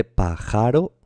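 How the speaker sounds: pájaro said slowly as pa-JA-ro, middle syllable stressed; wrong stress on JA. Shown as a demonstration. Teacher testing stresses aloud, pájaro slowly stressed on middle JA. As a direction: misplace the stress of pájaro onto ja, questioning. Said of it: "Pues tampoco se dice pájaro".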